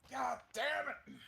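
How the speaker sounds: high loud frustrated outburst; frustrated yell. Real, not acted. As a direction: yell it at the bad roll. Dice result frustration.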